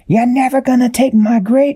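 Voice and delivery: high, sneering villain voice. A narrator's reading. Blue Meanie taunting the crew, high sneer. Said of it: "The Blue Meanie said".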